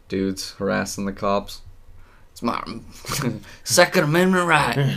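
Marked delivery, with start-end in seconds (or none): 2.3-5.0 high, loud sneering gun-rights guy; mock the entitled open-carry dude; mocking gun nut voice. Mocking gun dudes harassing cops.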